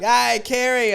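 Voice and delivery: high, loud mocking hail; jeering hello across the gym; calling out. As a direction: sing out his name to get his attention. Calling out to politician.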